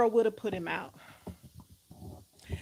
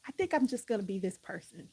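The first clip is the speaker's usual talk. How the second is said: ordinary delivery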